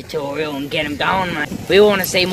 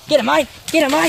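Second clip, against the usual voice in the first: high, loud and urgent; excited urging; 9 semitones higher, 5 dB louder, faster than usual. Real, not acted. Mate spots the eel and loudly urges a grab. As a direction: yell at him to grab the eel.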